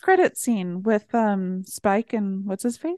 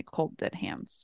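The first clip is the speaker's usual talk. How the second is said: dramatic hush; 15 dB quieter, slower than usual. Acted. Defiant joke on teeth.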